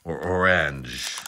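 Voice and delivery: badly faked French accent. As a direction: try the word in a clumsy French accent, drawn out. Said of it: "really bad French accent".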